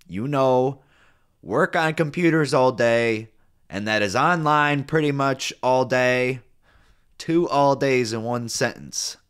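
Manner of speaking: annoyed nitpicking. Real, not acted. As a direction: hammer out the offending words. Mocks the repeated wording, annoyed.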